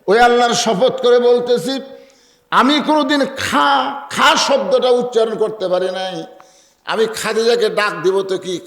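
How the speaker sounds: high, ringing preacher's oath; impassioned sworn declaration. Acted. (উঁচু গলায় শপথ করে ওয়াজ)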